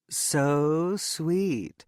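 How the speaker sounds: very high, gushing cheerleader squeal of delight; high-pitched pom squad impression, drawn out. A narrator's reading. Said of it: "in her highest-pitched Pom Squad impression".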